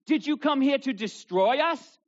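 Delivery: high, loud demonic challenge; demon's cry. Retold or imitated. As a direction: shout it up at Jesus. Demon voiced in Bible reading.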